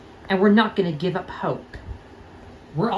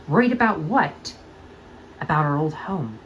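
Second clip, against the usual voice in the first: usual speaking voice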